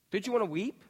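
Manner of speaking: ordinary delivery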